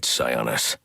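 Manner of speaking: ordinary talk, nothing marked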